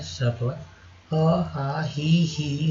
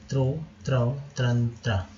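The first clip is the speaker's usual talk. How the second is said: normal talking voice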